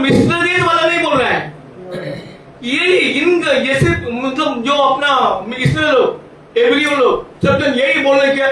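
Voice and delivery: full-force preaching. Acted. Preacher retells the quarrel with Moses to congregation, forceful preaching. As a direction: drive the story home to the congregation.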